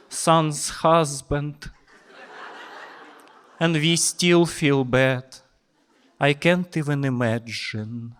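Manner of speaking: high, lightly voiced fussing granny; a hint of a nosy old lady cooing over a boy; pitying old lady. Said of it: "she was talking to me".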